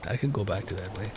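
switching voices back. Acted. GM going back to Tom's voice for the players, soft Irish brogue. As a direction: drop back into the hushed old voice, resigned. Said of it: "I'll talk like this again".

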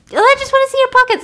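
squeaky hammed-up cop, rushing it; captain mocking John, high and loud. Captain mocks, pushing John.

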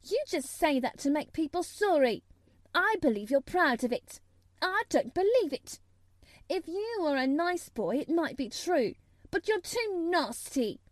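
high-pitched, scathing scolding; sour. A narrator's reading. Mary scolding Colin for self-pity, sour. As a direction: lay into him sourly. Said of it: "said Mary sourly".

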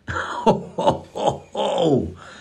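a quick unvoiced burst of laughter; short real laugh, rising high. Real, not acted. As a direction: laugh at the memory. Laughing at daughter grabbing flower.

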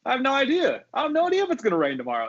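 baffled retort climbing high in pitch; high, incredulous protest; mock exasperation. Retold baffled reply to guest.

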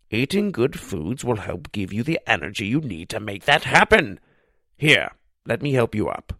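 lightly voiced old man, knowing; a hint of a folksy old owner; wise old pizza man. A narrator's reading. Old Pete lecturing Josh, lightly voiced kindly old man.

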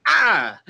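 short mock cry of pain; imitated pain yelp. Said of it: "it hurt her, and she's like".

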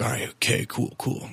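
soft, almost whispered give-in; mock-quiet guy caving to the neighbour's request; sheepish mumble. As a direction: back down quietly, under the breath. Homeless man backing down.

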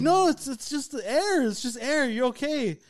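put-on high-pitched calming of a frightened kid; retold coaxing, voice pushed up high; urgent reassurance.

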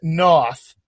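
north said nah-th, open rounding, Boston accent; Boston accent north with loose lips. Shown as a demonstration. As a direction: drop the R in north, Boston style. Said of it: "When we do it in this accent".

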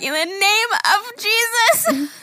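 high, slow, drawn-out mock command; mock righteous proclamation. Host teases about the child standing up to yell, mock high proclamation. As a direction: proclaim it like an overblown child exorcist. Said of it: "you stood up and you're like".

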